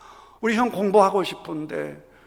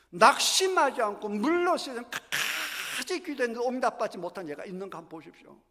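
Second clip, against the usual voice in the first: usual speaking voice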